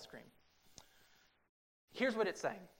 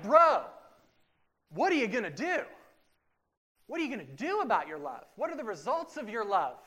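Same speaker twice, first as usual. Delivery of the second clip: loud, higher pushing challenge; challenging friend; 5 semitones higher, 8 dB louder than usual. Retold or imitated. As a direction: press your buddy hard.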